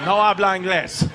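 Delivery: 假装只会西班牙语，平常音量; casual mock Spanish dodge "No habla inglés". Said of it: "And I was like".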